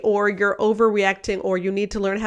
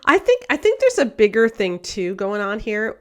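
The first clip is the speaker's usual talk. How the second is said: ordinary talk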